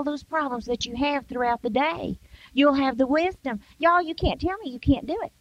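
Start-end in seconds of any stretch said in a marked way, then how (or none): none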